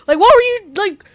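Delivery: loud, fed-up ranting at a game character; exasperated rant. Retold or imitated. Lore nerd yelling at a useless NPC, exasperated loud rant. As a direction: vent like you've been personally betrayed by the NPC. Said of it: "am ranting to myself".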